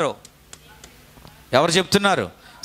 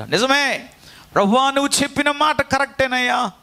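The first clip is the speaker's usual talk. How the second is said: Peter's lament; 9 semitones higher than usual. Retold or imitated. Peter's regretful words voiced.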